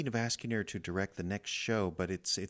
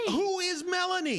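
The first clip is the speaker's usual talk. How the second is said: loud, high panicked shout; shouting back; yelling; 6 dB louder than usual. Acted. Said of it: "I'm yelling because you're yelling".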